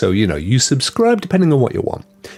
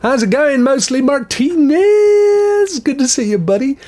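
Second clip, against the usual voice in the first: silly high greeting; 4 dB louder than usual. Acted. Greeting chat viewer in silly voice.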